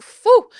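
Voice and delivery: high, loud mock phew; exaggerated relieved exhale; loud sigh of relief. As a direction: blow out the relief dramatically. Relief no gecko.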